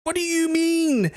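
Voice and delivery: high astonished squeal; high disbelief. Retold or imitated. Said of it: "And I was like".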